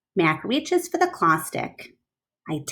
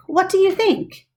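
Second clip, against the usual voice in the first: much higher, bright asking; excited question; 12 semitones higher, 3 dB louder than usual. Shown as a demonstration. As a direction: ask it eagerly, high up. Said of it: "I stand back".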